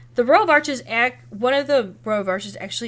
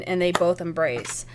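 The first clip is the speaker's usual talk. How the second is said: ordinary talk, nothing marked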